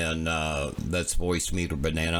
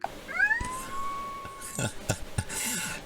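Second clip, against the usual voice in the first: soft high giggle; 6 dB quieter than usual. Real, not acted. Giggling at the puppy video.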